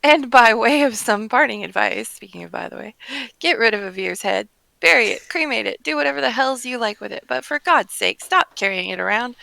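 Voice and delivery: loud, emphatic parting lecture; acted exasperated telling-off; exasperated adventurer. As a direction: lay down the advice with fed-up force. Reads scornful parting advice.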